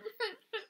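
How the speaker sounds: put-on high, small child's sobbing; little sad crying. A narrator's reading. Unseen child in the dark, crying sadly. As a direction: whimper and sob like a small child. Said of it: "the little sad crying again".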